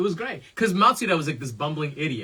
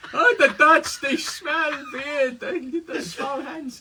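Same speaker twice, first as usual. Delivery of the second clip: put-on loud, high Dutch accent, slowing down; 10 semitones higher, 5 dB louder, slower than usual. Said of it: "Working on it".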